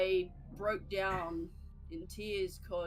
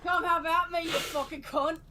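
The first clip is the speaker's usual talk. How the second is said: Aussie bogan; 8 semitones higher, 9 dB louder than usual. Pretend. Mock Aussie pub brawler.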